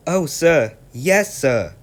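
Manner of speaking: Percy eagerly promises to work hard, high and loud. Acted. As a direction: bounce with eagerness to please. Eager new engine answers.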